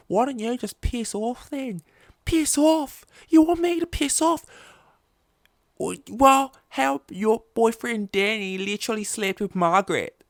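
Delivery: Australian soap friend screaming the secret; screaming soap argument. Acted. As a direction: blow up like a soap-opera catfight. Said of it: "convoluted way of bad accents".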